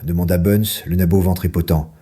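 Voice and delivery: ordinary delivery, nothing marked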